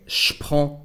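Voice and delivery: "je prends" said sh-pran; je becomes sh. Shown as a demonstration. Teacher showing the first contraction, "je prends" said as sh-pran. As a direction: put sh straight onto "prends". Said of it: "the sound sh to replace je".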